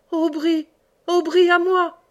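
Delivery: high, loud desperate call; crying for help. A narrator's reading. Reine calls Aubry to save her, shouting high. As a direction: shout his name across the water. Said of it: "cria Reine".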